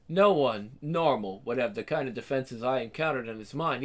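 ordinary talk, nothing marked

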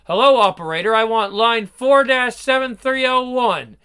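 loud call. Acted. Husband calling the operator.

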